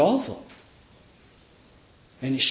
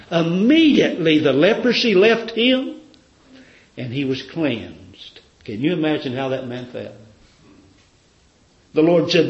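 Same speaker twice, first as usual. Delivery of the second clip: ordinary talk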